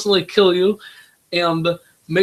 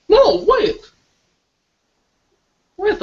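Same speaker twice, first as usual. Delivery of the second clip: sudden correction; 14 semitones higher, 4 dB louder than usual. Real, not acted. Child suddenly corrects himself.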